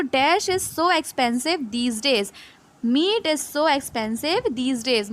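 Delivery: slow, careful classroom read of the example sentence; teacher dictating an example sentence; slow reading aloud. Shown as a demonstration. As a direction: read it slowly for students to follow. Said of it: "ये वो वाला है".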